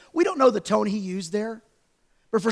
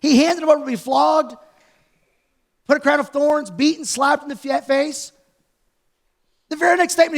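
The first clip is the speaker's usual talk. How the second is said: usual speaking voice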